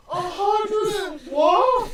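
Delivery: very high, slow squawk of disbelief; a dwarf shrieking at the number; outraged dwarf. Acted. Dwarf shrieks at the gate guard in disbelief. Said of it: "this dwarf just go".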